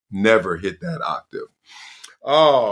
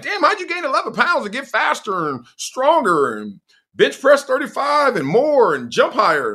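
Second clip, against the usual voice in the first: amazed disbelief; 12 semitones higher than usual. Retold or imitated. Amazed scouts quoted.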